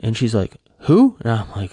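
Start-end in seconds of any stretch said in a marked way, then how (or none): 0.8-1.2 high, loud puzzled who; puzzled question. Retold or imitated. Wife not knowing who Marty is, high puzzled question. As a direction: squeak it out, clueless and surprised.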